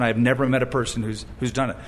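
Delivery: normal talking voice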